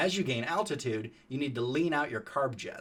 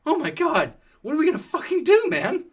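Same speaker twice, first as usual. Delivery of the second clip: panicked, much higher and louder, fast; freaking out; 10 dB louder than usual. Retold or imitated. Tyler after the ranger leaves, panicked high yelling. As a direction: spiral into panic. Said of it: "Tyler is freaking out".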